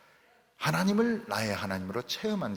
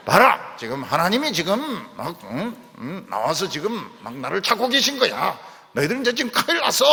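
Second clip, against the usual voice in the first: much louder, bold warning, last line fast; confident taunt; 5 semitones higher, 7 dB louder than usual. Pretend. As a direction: boast that God is coming for them. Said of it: "이런 식으로 해석을 잘해야 됩니다". (훨씬 크게 당당하게 외치는 경고, 끝은 빠르게)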